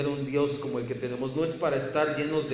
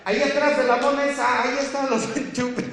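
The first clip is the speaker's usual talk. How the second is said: high, raised retold yell breaking into a laugh; mock shout; 10 semitones higher, 4 dB louder than usual. Brother yells about the sockets behind the statue, mock shout. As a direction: call it out high, as if across a pool. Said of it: "y le grita".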